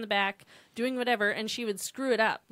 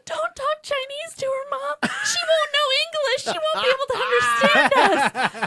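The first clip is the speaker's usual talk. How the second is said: put-on high, loud teen daughter complaining; annoyed daughter; 13 semitones higher, 7 dB louder than usual. Older daughter telling her mom to stop, high and complaining. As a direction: whine like an embarrassed teen. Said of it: "the older daughter said".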